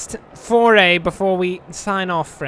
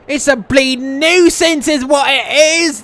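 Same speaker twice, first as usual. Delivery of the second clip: a louder, higher thick Yorkshire grumble; grumbling Yorkshire accent; 6 semitones higher, 8 dB louder than usual. A narrator's reading. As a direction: grumble it in broad Yorkshire, fed up. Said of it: "grumbled in a thick Yorkshire accent".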